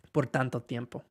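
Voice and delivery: ordinary delivery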